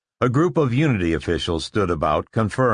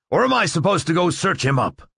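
demanding; 9 semitones higher than usual. Acted. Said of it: "Barris demanded".

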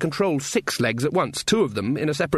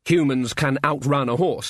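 ordinary delivery

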